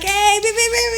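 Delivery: high, bouncy horn calls; imitated beeping. Beeping at little sister on the freeway, high-pitched. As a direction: call out and honk with the voice. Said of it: "you know, like, beeping at her".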